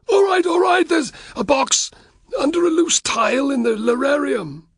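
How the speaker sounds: high, frantic voice of a cowering fat man; terrified Varus. A narrator's reading. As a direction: blurt it out in a high panicked rush. Varus voiced, terrified under threat.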